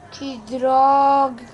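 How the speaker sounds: loud, slow, drawn-out curse; frustrated shout. Acted. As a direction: bellow it in frustration. Leg burned, frustrated.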